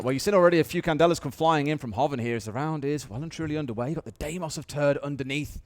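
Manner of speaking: ordinary delivery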